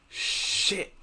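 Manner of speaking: drawn-out whispered curse; Juggernaut. Acted. Game master voices a character ending the scene, whispered curse. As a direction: whisper it with a long "sh". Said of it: "and Juggernaut goes".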